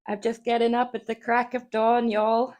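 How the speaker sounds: high, loud, hammed-up American; American accent. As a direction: greet chat as a fake American. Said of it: "I can just be like".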